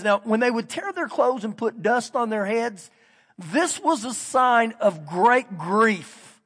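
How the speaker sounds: normal talking voice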